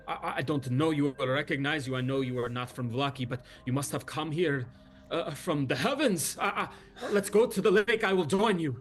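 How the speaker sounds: a flustered leader jumping at hope; eager Luvash. Acted.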